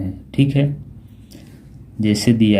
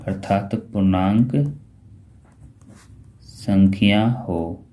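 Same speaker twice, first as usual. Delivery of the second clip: slow dictation; slower than usual. Shown as a demonstration. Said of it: "dictating slowly".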